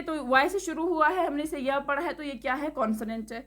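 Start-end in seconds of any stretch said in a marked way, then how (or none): none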